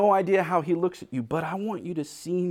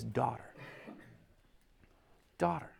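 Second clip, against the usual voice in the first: soft, quiet "Daughter", said like a loving father; tender "Daughter"; 6 semitones lower, 13 dB quieter than usual. Retold or imitated. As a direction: say "Daughter" gently, almost under the breath. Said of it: "he uses this family term".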